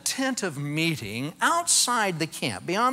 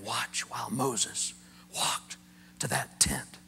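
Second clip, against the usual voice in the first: hushed whisper; 6 semitones lower, 8 dB quieter, slower than usual. Shown as a demonstration. Preacher whispering to the congregation as Moses walks by. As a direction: drop to a breathy whisper, slowing down.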